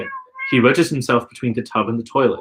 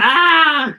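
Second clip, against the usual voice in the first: sharp high yell of pain; 6 dB louder than usual. A narrator's reading. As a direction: cry out loud in pain. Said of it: "your father yells".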